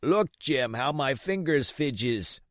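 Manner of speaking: put-on slow, begging old seaman; sick captain pleading with Jim. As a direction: plead with the boy for a drink. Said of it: "he continued in the pleading tone".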